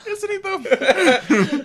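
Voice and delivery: slow, high, laugh-filled question; amused drawn-out teasing through laughter; laughing tease. Real, not acted. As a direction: drag it out while cracking up.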